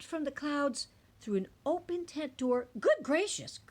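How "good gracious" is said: high, startled gasp; a surprised Maisie gasps; a touch of shock in a high gasp; gasped surprise. A narrator's reading. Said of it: "gasped Maisie".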